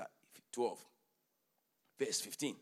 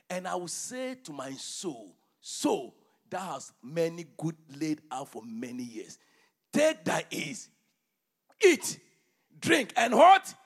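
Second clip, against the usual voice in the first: preaching at full force; 12 dB louder, slower than usual. Acted. Preacher reading Haggai aloud to his church, booming. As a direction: thunder the prophet's words over the congregation.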